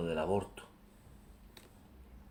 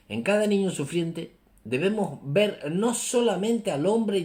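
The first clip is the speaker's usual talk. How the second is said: emphatic, raised reading voice; solemn reading aloud; 6 semitones higher, 3 dB louder than usual. Real, not acted. Preacher reads a Pope's words on suffering children, solemn and emphatic. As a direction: declaim each phrase with weight.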